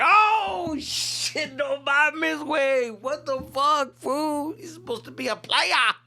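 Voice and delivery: high, loud mock outrage; heckling crowd reaction. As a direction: jeer in disbelief like a scandalized buddy. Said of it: "I don't know what everybody's thinking, like".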